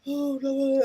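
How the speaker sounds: high-pitched mock whine of protest; moaning.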